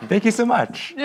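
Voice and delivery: laughing. Real, not acted. Laughing after the song.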